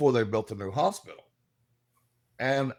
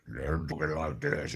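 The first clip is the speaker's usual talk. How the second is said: imitating a demon, much lower and slow; mock demonic voice; 11 semitones lower, 7 dB quieter, slower than usual. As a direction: drop the voice low and drag it like a mock demon. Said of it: "acted like they were demonic".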